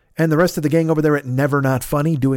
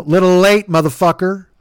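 loud; 3 semitones higher, 6 dB louder than usual. Acted. Mock insult to listener.

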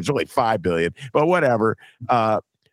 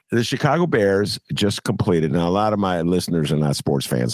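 usual speaking voice

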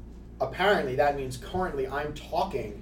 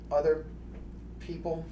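ordinary talk, nothing marked

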